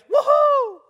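loud, high joyful whoop; gleeful fishing holler; excited whoop. Retold or imitated. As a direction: let out a big happy yell like landing a fish. Fisherman whoops on catching fish.

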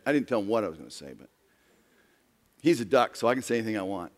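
ordinary talk

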